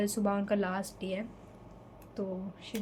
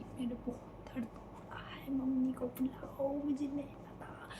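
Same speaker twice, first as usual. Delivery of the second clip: slow, much softer whisper in pain; weak pained whisper; 3 semitones higher, 7 dB quieter, slower than usual. Retold or imitated. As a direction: barely get the words out to papa. Weak phone call to papa in pain. (पापा को phone पर दर्द में धीमी फुसफुसाहट)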